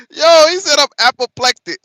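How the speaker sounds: high, loud, cracking with laughter; laughing disbelief. Real, not acted. Streamer laughing at the politician's word, half crying. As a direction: squeal it through laughter.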